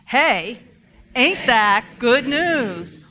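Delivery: loud, slow, stretched-out AIN'T, falling at the end; rousing call and response. Shown as a demonstration. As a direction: shout it back to the preacher with joy. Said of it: "Shall we practice?".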